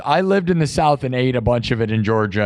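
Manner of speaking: ordinary talk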